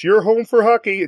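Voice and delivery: put-on Canadian accent, lifted pitch. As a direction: try a Canadian accent on the slogan. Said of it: "Your, your Canadian accent's probably better".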